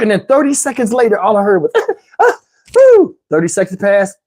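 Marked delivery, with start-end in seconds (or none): 2.2-3.2 loud, high whooping snore-out; comic snore with a high whistling exhale; exaggerated snore imitation, loud on the woo; imitated snoring. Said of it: "I hear snoring".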